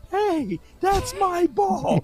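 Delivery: high, stretched-out imitation; Playmaker high-pitched voice. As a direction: call it out high and slow, as a mimic. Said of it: "to say the same thing".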